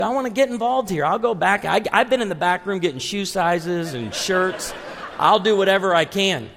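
high-pitched, keen offer to a customer; eager store helper. Acted. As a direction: play the over-helpful shop assistant.

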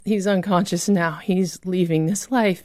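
ordinary delivery

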